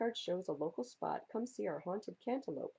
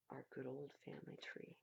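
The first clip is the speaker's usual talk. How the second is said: soft, hushed close; whispered ending; 6 semitones lower, 13 dB quieter, faster than usual. Acted. Reader ending the family poem, whispered. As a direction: let it fade to a whisper.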